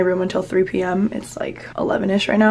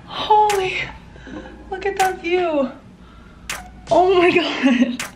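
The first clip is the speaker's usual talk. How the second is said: high, slow, thrilled exclamations; shrieking jackpot winner; 9 semitones higher, slower than usual. Real, not acted. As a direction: gasp at an amazing view like you just won. Seeing the view, thrilled.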